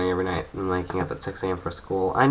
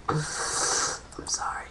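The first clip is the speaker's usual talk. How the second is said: laughing whisper; 9 dB quieter, much slower than usual. Real, not acted. Laughing apology.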